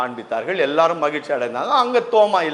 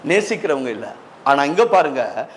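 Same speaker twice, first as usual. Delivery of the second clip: ordinary talk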